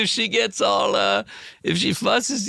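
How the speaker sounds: high, carefree mock-Woody voice; imitating Woody. Woody brushing off the baby worry, high carefree voice. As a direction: toss it off high and easy. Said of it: "He goes".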